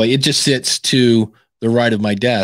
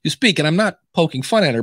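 ordinary talk, nothing marked

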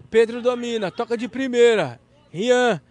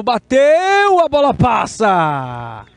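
excited commentary; 5 dB louder, much slower than usual. Real, not acted. Shot in live commentary.

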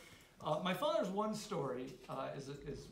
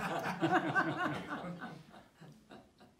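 laughing; 9 semitones higher, 3 dB louder than usual. Real, not acted. Laughing at the story.

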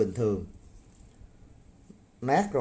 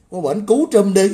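yelling the dare much louder; angry shout; 5 semitones higher, 10 dB louder than usual. Real, not acted. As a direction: shout it at the trolls, furious. Heated rant daring commenters. (hét lên giận dữ)

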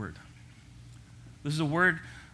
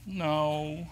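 put-on toddler's no; 3 semitones higher than usual. Toddler Cade refusing the raisin.

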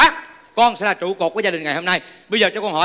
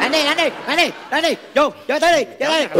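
urgent egging on, higher; goading shouts; 9 semitones higher than usual. Acted. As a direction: cheer the beating on. Urging a beating in a skit.

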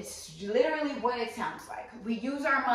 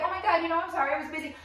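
gushing apology; 8 semitones higher, faster than usual. Acted. Acts love bomber's gushing return.

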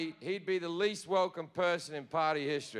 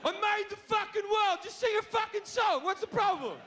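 God angry shout. Retold or imitated. God yelling at partygoers who hesitate to sing, furious shout. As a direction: yell it like a furious deity. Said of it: "And they're like".

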